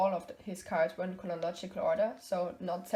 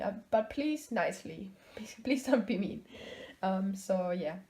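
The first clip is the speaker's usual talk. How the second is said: ordinary talk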